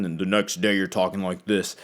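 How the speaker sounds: suddenly deep, overcompensating voice; overdone deep voice. Shown as a demonstration. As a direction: drop into a forced low register. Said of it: "seem like you're overcompensating".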